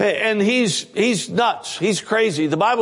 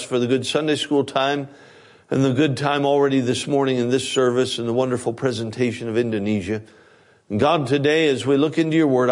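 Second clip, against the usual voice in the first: pastor's prayer; 6 semitones lower than usual. Acted. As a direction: pray over the congregation reverently. Leads an opening prayer.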